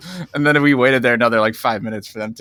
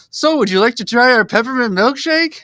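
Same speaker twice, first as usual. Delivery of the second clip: much higher, bright salesy offer; sudden fake cheer; 11 semitones higher, 4 dB louder than usual. Worker spotting the manager, suddenly pitching a milkshake to the customer: fake cheer. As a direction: switch to service-smile mode instantly. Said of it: "real suddenly cheery".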